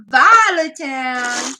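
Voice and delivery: loud, long drawn-out name; showy name call. Real, not acted. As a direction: announce it with a big stretched flourish. Reads out queue names.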